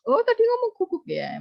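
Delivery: mock surprise. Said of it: "Misalkan guguk".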